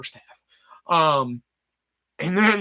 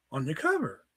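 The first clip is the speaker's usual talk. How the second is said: usual speaking voice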